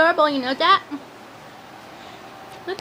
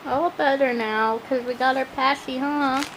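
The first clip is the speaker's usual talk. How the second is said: soothing low coo; 9 semitones lower, 5 dB quieter than usual. Real, not acted. Soothing baby after pacifier.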